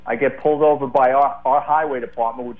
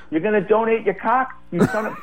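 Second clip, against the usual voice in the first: high, incredulous retold outburst; cursing cop; 7 semitones higher than usual. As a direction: imitate an outraged cop cursing out a driver. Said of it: "he's just cursing at me".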